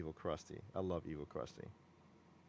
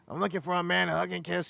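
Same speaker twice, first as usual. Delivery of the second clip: high, cartoon kid voice; Bart impression; 8 semitones higher than usual. Retelling the Talking Krusty phone prank, high cartoon kid voice. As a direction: pitch way up like a prank-calling boy. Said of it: "you know, like that".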